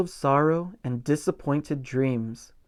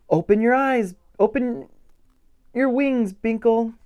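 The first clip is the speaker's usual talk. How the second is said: calling out; 7 semitones higher than usual. Acted. Butterflies calling to Binkle, a higher encouraging call. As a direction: call to him across the garden. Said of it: "they all called".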